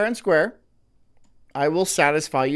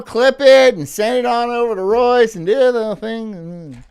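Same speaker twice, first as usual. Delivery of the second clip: loud, high, fired-up; triumphant; 7 semitones higher, 9 dB louder than usual. Real, not acted. Streamer telling chat to clip it, loud and triumphant. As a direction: shout it out, done at last. Finishing the forced read.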